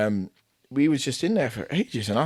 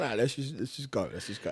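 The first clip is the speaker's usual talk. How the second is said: quiet urging; 9 dB quieter than usual. Retold or imitated. Coach quietly urging leave.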